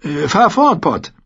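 high, loud, eager prompt; pressing him to continue; urging. A narrator's reading. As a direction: urge the boy onward. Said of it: "drängte er".

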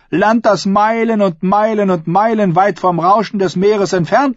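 high-pitched, forceful oratory to a hall; defiant female claimant. Acted. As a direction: challenge the crowd like a contender for the crown. Asha challenges her uncle.

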